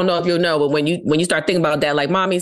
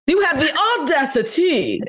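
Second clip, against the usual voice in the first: much higher, slow, laughing diva line; grand auntie diva; 12 semitones higher than usual. Pretend. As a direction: play the outraged grand dame with a laugh. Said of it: "you should say things like".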